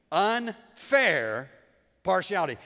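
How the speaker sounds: "Unfair" loud and drawn out, then "partiality"; emphatic repeat. Shown as a demonstration. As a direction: punch "Unfair partiality" loudly, pausing between the words. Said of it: "let me say it again".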